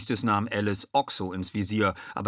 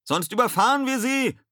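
high, loud shout; urgent raised voice; bellowed warning; 7 dB louder than usual. A narrator's reading. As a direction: shout the danger to the crew. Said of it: "brüllte Ed".

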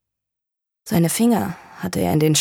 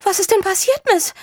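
put-on much higher, alarmed older woman's voice; startled housekeeper. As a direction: play the frightened housekeeper calling out. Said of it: "rief Misses Ewell".

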